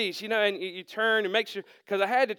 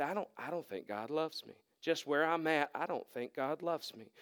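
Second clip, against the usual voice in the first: despairing hush; 6 semitones lower, 11 dB quieter than usual. Pretend. Voicing a doubting listener.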